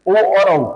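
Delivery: ओ and औ sounded out loudly and clearly; vowel demo. Shown as a demonstration. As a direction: voice ओ then औ so the class hears both. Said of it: "यहाँ से थोड़ा सा आपका होता है आपका ओ". (ओ और औ ज़ोर से बोलकर दिखाना)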